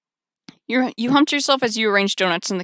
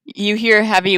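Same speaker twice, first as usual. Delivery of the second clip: ordinary talk, nothing marked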